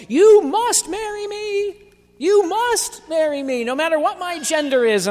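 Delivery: high, loud mocking voice pressing a demand. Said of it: "someone will come along and say".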